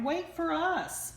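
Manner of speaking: high, slow plea; crying out. A narrator's reading. Ducklings begging mama to wait, high and slow. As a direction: call after her to wait. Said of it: "they cried".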